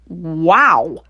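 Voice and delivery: mild gasp of surprise; a hint of a burning gulp. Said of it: "he gasped".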